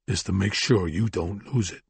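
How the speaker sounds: low menace forced through gritted teeth. Acted. Brennan threatening the thug, through gritted teeth. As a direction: grind out the threat behind clenched teeth. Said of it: "Brennan said through gritted teeth".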